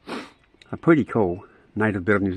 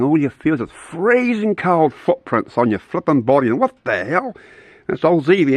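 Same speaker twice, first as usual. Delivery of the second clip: ordinary delivery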